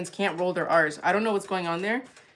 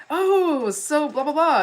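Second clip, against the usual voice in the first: lightly voiced eager people, higher and louder; mock eager reaction; 8 semitones higher, 5 dB louder, much slower than usual. Mocking people who learn she's Puerto Rican, eager voice. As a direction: imitate people gushing back at her. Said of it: "they're like".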